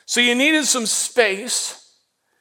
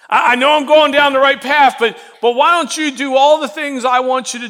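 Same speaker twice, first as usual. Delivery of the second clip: loud, frustrated outburst, louder and higher; mock shout at God; 3 semitones higher, 6 dB louder than usual. Believer shouting his complaint at God, exasperated and loud. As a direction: play the believer yelling at God for not blessing him. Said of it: "where I'm like".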